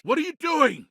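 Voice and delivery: high, sharp shout of alarm. Acted. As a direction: yell it at someone doing wrong.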